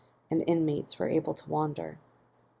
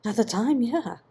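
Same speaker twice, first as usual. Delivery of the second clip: pleading and a touch higher; a desperate, lightly raised reply; 7 semitones higher, 3 dB louder than usual. A narrator's reading. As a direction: answer desperately, voice lifted. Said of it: "Ian replied desperately".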